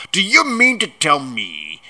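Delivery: loud, high, outraged growl; giant's rising fury; angry growl. A narrator's reading. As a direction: growl the accusation loudly. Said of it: "he growled at the Dursleys".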